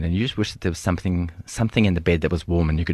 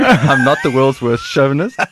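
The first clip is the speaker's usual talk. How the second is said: laughing; 5 semitones higher, 8 dB louder, slower than usual. Real, not acted. Laughing as he admits the joke.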